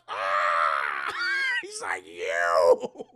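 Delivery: loud hammed-up wounded yell; mock pained roar. Chucky shot by Andy, loud hammed-up roar. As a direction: howl as the arm goes.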